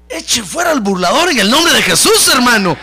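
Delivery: loud, high preacher's shout; preaching at full force. Real, not acted. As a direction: shout it out to the congregation. (prédica a gritos, con toda la fuerza)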